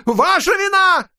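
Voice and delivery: «Ваша вина» выкрикнуто ещё выше и громче; сорвись на крик; яростный крик. A narrator's reading. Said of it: "сорвался на крик". (крик ярости)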